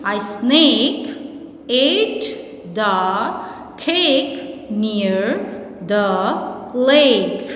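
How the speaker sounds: "A snake ate the cake near the lake" read again slowly, each word apart; slow second reading. Shown as a demonstration. Teacher rereads the sentence slowly for students, word by word. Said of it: "can you read it again?".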